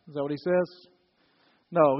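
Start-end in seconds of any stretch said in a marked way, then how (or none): none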